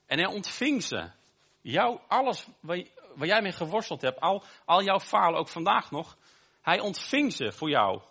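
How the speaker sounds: ordinary talk, nothing marked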